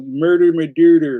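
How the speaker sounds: a hint of a Delco Philly accent on Murder, My Duder; mock Delco accent. Mocking the SNL sketch, lightly doing a Delco accent. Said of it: "making fun of it on S N L".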